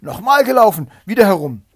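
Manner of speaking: high, very loud, eager shout; frantic yell. A narrator's reading. Hare beside himself demanding another race, frantic yell. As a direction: yell it, beside yourself with zeal. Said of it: "ganz außer sich vor Eifer und schrie".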